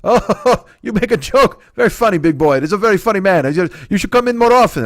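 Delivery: high, amused old shopkeeper imitation; old deli man. Deli owner enjoying a customer's joke, high and tickled.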